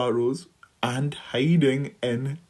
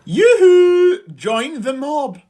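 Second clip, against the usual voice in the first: loud yoo-hoo shout; 11 semitones higher, 4 dB louder, slower than usual. A narrator's reading. The four animals welcome the stork with a loud calling shout. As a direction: hail them from afar. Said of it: "the other four shouted".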